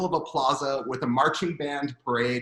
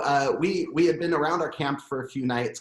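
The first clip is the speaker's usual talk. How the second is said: ordinary talk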